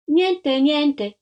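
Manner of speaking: put-out mother's dismissal; dismissive mother. A narrator's reading. Offended mother dismisses the lady's question.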